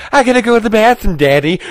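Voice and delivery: put-on high, fast, whining child; whiny kid voice. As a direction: whine like a kid in the back seat. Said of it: "the kids in the back going".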